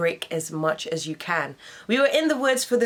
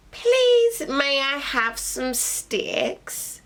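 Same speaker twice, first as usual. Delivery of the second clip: high little pig, slow and pleading; 7 semitones higher, much slower than usual. Acted. Second little pig begging the man for sticks. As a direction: stretch it out like a timid little pig.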